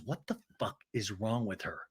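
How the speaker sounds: low, very soft appalled question; mock hushed disbelief. Recapper imitates Jen reading the texts, hushed disbelief. Jen's appalled aside acted.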